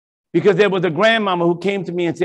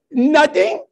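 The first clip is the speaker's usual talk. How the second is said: put-on innocent kid; 7 semitones higher than usual. Kids denying to mama.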